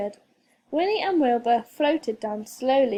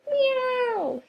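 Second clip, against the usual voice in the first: a much higher, worried cat's meow; cat calling out; 12 semitones higher than usual. A narrator's reading. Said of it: "he called".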